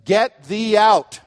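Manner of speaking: loud, high, slow imperative; emphatic command. Acted. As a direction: order them out, drawing out each word. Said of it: "say in an emphatic voice".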